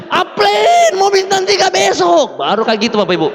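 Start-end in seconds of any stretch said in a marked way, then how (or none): 0.1-2.3 high, slow shout of amazement; drawn-out amazed announcement. Retold or imitated. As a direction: call it out stunned to the room. Upline calls out in amazement.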